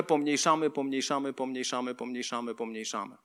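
steadily fading and dropping in pitch; shrinking repetition. Shown as a demonstration. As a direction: let each repeat sink smaller, down to very soft. Repeated word mimics shrinking people.

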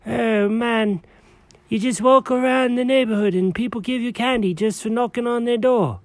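normal talking voice

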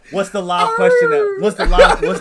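high, loud excited question. Real, not acted. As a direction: push the question with laughing excitement.

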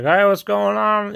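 high, slow drawn-out mock holler; half-hearted drunk yelling; imitated drunken yell. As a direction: imitate a sloshed man calling out. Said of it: "he's yelling in German".

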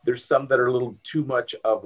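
ordinary talk

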